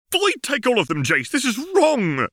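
high, sharp spitting anger; spat. Acted. Caitlin spitting her outrage at Jace. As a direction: spit the words at him. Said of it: "Caitlin spat".

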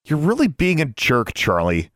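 scolding. A narrator's reading. Girl to her misbehaving raven, scolding.

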